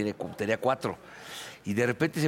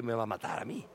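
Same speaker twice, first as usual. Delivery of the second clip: retold fearful thought; 8 dB quieter than usual.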